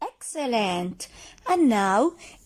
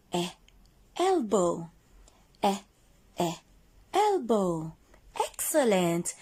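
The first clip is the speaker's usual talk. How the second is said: ordinary talk, nothing marked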